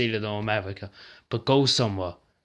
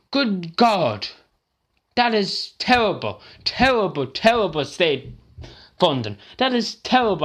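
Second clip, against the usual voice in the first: anguished outrage; 7 semitones higher than usual. Real, not acted. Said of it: "I'm nearly about to cry here".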